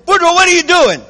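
high, loud startled wife; wife calling out. Acted. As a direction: shout it across the kitchen at the husband. Wife catching husband at table.

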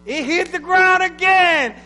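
dramatic climax. Acted. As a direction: hammer it home from the pulpit. Climax of the fable.

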